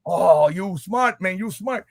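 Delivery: put-on broken English Cuban accent; proud Cuban uncle voice. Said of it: "What happened to the Cuban accent?".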